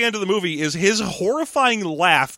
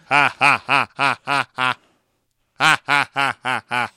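evenly spaced, lowered robotic ha-has; monotone laugh; 4 semitones lower than usual. Retold or imitated. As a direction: repeat ha on one note like a stuck machine. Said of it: "it's way more monotone than that".

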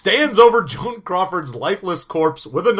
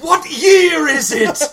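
bewildered time traveller; 12 semitones higher, 8 dB louder than usual. Pretend.

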